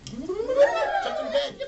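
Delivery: high, loud, laughing whoop; gleeful yippee. Acted. Toymaker jumping into bed with a high, laughing whoop. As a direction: squeal with childish glee.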